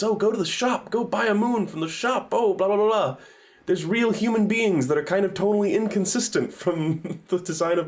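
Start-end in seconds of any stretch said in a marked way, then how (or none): 0.0-3.2 higher sing-song mockery; dismissive, high-pitched parody; mocking sales pitch. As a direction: rattle it off like a silly game prompt. Mocking modern game design.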